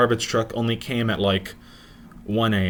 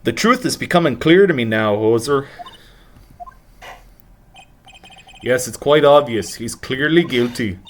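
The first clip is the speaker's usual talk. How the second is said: a hint of a Canadian accent, a bit louder; 2 semitones higher, 3 dB louder than usual. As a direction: lightly mimic a Canadian lawyer. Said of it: "I'm trying to do Canadian".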